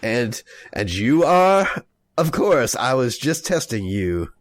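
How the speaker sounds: slow, halting fake French accent; terrible French accent. Impostor covering his slip with the family, terrible French accent. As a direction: bluff slowly in bad French-accented English. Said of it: "with my terrible French accent".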